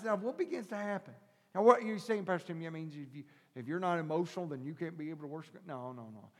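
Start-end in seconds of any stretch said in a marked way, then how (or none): none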